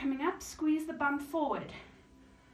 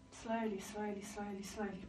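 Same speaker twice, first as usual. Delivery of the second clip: soft coaxing; 3 semitones lower, 7 dB quieter than usual. Real, not acted. Coaching a slow rise.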